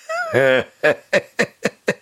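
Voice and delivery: put-on rising old witch cackle. A narrator's reading. Baba Yaga laughs.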